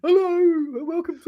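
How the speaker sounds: high squeaky presenter hello; Roland Rat voice. Pretend. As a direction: mimic the cartoon rat host. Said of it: "Ricky Sachs is Roland Rat".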